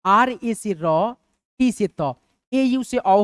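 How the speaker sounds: very slow letter by letter; slow spelling out. Shown as a demonstration. Teacher dictating slowly to students writing names. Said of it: "इसका English हम लोग कैसे लिखेंगे".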